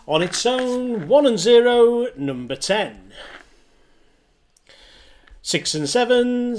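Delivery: sing-song rising then falling number calls; bingo caller. Acted. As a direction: call each number like a bingo hall. Online bingo number calling.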